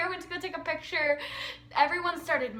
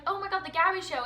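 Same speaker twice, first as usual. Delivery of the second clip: ordinary talk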